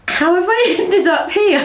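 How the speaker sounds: high-pitched, laughing incredulity; amused bewilderment. Retold or imitated. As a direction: drag it out slowly, cracking up. Retells bewilderment at being in Holland.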